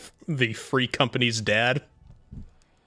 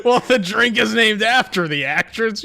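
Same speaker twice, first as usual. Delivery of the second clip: laughing; 6 semitones higher, 5 dB louder than usual. Real, not acted. Explaining while laughing hard.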